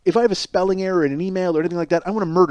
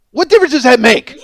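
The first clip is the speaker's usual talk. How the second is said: raised, louder mock outrage; indignant shout; 5 semitones higher, 7 dB louder than usual. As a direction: yell back in disbelief at the cop. Retold outburst at the cops.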